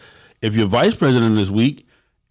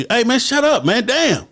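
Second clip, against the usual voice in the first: higher, exasperated order; frustrated president; 12 semitones higher than usual. Retold or imitated. Fed-up president silences a member: high exasperated snap. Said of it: "a president out of frustration".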